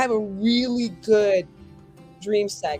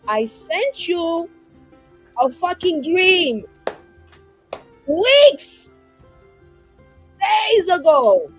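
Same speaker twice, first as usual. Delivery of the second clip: drawn-out, higher-pitched sulky pout; horribly pouting; 4 semitones higher, 5 dB louder, much slower than usual. Acted. Player as a sulking sender scolding by dream, horribly pouting. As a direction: pout hard, punching and stretching the words. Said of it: "like, really horribly pouting".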